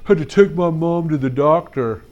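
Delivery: a hint of a kid's mumbly excuse voice, a repeat take; lightly voiced boy excuse again in his voice; Barry's voice. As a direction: imitate the boy's mumble. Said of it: "that's exactly how he sounds".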